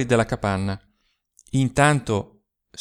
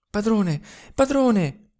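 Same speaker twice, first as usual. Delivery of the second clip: breathless cry; 9 semitones higher, slower than usual. Acted. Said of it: "con voce ansante, soffocata".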